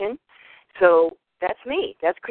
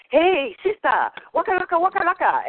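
mock local greeter; 13 semitones higher than usual. Locals greet her as one of their own in Hawaii, high-pitched mock greeting. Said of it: "they would walk up to me in Hawaii and say".

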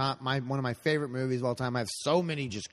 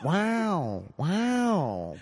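Owen Wilson wow; 6 semitones higher, 3 dB louder than usual. Pretend. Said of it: "a wow at the beginning".

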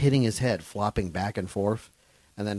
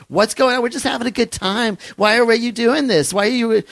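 high, loud, shrill-pitched yell into a dead mic; mock heckler yelling; 10 dB louder than usual. Heckler yells at comic into unplugged mic, high loud shout. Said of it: "she starts yelling into this empty".

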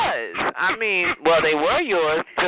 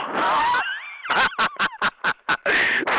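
shrieking high cackle at her; real howl of laughter at her. Real, not acted. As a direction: burst out in a mocking, shrill laugh. Mocking caller on a stream.

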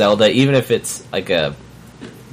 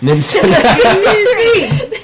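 excited squeal; 6 dB louder, much slower than usual. Real, not acted. Squealing over the Muppet reveal.

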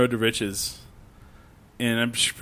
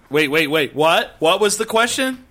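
mock clueless crowd; 6 semitones higher, 6 dB louder than usual. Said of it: "then they're like".

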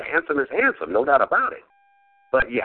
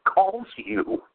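ordinary delivery

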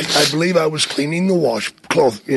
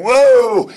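higher imitated yelp; startled whoa; 7 semitones higher, 4 dB louder than usual. Jake dodging the biting snake, imitated startled cry. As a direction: cry out as if jumping back.

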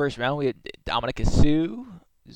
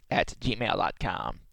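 ordinary delivery

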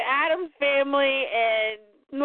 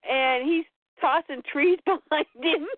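ordinary talk

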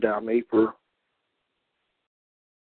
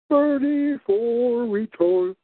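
mocking drawl; 10 semitones higher, much slower than usual. Drawn out sing-song.

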